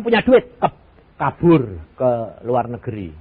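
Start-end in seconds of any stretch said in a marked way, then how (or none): none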